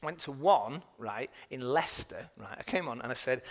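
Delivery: normal talking voice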